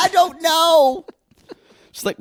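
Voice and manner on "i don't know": high, drawn-out mock voice; Natalie. Host imitating a woman dodging a question, high and drawn-out. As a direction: stretch it out high, mocking her indecision. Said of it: "She does say".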